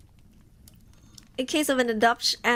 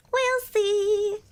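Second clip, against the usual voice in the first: high put-on voice; 8 semitones higher than usual. Repeats joke in put-on voice.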